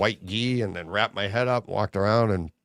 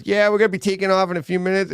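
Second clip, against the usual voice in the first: put-on Boston accent; 7 semitones higher, 5 dB louder, faster than usual. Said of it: "It was the Boston accent".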